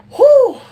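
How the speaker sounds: high, loud "whoo"; real high-pitched whew. Real, not acted. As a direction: let out a big high whew of relief. Tired streamer lets out exhalation.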